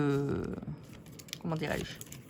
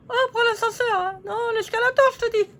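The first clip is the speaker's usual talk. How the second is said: high squeaky paper-doll voice, bickering; squeaky puppet lady; 13 semitones higher, 4 dB louder, faster than usual. Acted. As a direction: play a cheeky little figurine squabbling with her neighbour. Figures argue escalator vs elevator.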